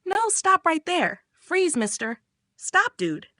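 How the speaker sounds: acted yell, held back; mock yell. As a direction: call out in mock alarm without much volume. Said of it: "She yells".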